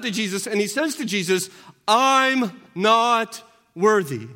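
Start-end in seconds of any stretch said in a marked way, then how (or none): 1.9-4.3 slow, louder, each word weighed; humble plea. Acted. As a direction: drag out I'm not worthy with weight. Centurion humbly pleads to Jesus.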